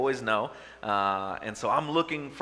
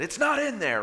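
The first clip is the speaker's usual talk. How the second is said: much higher, exasperated retort; exasperated insistence; 12 semitones higher, 3 dB louder than usual. Retold or imitated. Husband retells snapping back at wife's offer, exasperated. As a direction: insist in mock exasperation, pitch jumping up. Retold insistence to doubting wife.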